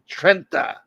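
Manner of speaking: "Trenta" repeated with Italian vowels and tapped r; lightly mock Sicilian "Trenta"; "Trenta" in a hint of Sicilian accent; Sicilian pronunciation. Shown as a demonstration. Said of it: "as they say in Sicilian".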